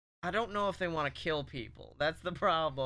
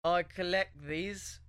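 slow British wizard voice; drawn-out, hammy British accent; bad British accent; 2 semitones higher, slower than usual. Wizard lecturing DeVito, slow bad British accent. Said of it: "he goes".

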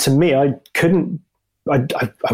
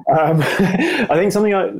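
high-pitched real laugh spilling into the words; amused, high, laughing hesitation; 9 semitones higher than usual. Real, not acted. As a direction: start talking while still laughing. Laughs after blunt answer "No.

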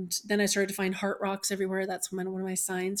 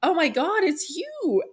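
much higher and louder, stunned joy; high, loud gasp of recognition; overwhelmed surprise; 10 semitones higher, 7 dB louder, slower than usual. Retold or imitated. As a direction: cry out at seeing him. Retold recognition of son.

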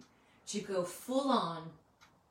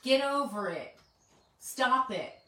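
louder, higher, curt brush-off; dismissive scolding; 5 semitones higher, 6 dB louder than usual. Retold or imitated. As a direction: mimic someone snapping at a depressed friend. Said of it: "And someone says".

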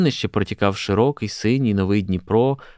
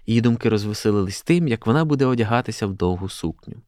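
ordinary delivery

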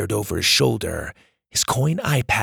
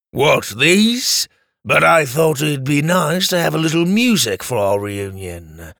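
sly villain voice; 3 semitones higher than usual. Acted. Drake mocking voice.

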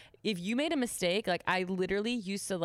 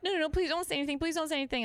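high, urgent acted begging; near-tears pleading; 11 semitones higher, much faster than usual. Waitress who needs her job, retold frantic pleading. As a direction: beg anxiously, as if nearly crying. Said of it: "I'm on the verge of tears".

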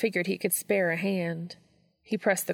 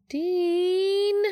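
high, eager cry of his name; calling out his name in thrilled excitement; excited call; 12 semitones higher, 4 dB louder than usual. Acted. Texting friend calls out to Dean, high and thrilled. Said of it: "Sorry, I'm just excited".

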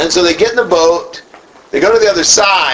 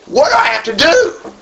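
exasperated cry; 11 semitones higher than usual. Acted. Acting out an exasperated reaction.

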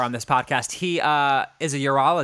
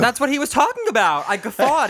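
loud, high, laughing protest; high-pitched indignant protest; excited outburst; 11 semitones higher, 5 dB louder than usual. Real, not acted. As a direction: burst out defending yourself.

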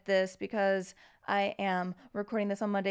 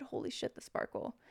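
whispering; 4 semitones higher, 9 dB quieter than usual. Real, not acted. Awed aside about love.

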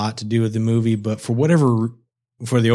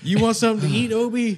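gentle caretaker voice; 12 semitones higher than usual. Retold or imitated. Retelling a movie scene, friend mimics a caring offer to Obi. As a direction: coax a freezing friend to eat.

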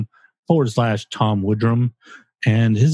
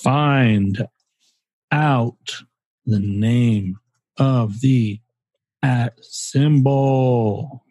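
slow dictation; much slower than usual. Pretend. First action item read out.

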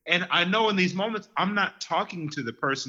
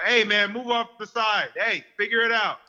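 grumpy snap; 6 semitones higher than usual. Retold or imitated. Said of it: "Me without sleep, I'll say something out loud".

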